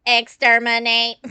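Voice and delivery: slow, loud robotic command; Dalek robot voice. Pretend. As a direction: bark it like a Dalek. Robot-voiced boss at press conference.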